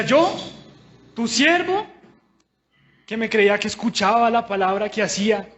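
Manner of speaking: high, loud cry of wounded pride; indignant protest. Retold or imitated. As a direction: protest to God, offended and hurt.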